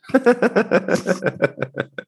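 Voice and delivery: high bursting laugh; real laughter at his own joke; big laugh. Real, not acted. Laughing after joking about caretakers.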